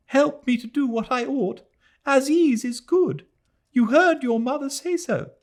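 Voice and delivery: put-on a girl clinging and begging; sobbing plea. A narrator's reading. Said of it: "and sobbed".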